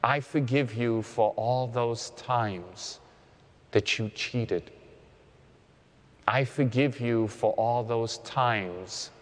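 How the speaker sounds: meditative prayer of self-forgiveness; guided prayer. Acted.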